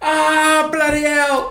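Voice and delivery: high loud Cockney cry of pain; mock Cockney yell. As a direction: howl it in a hammed-up Cockney. Retelling shot fingers.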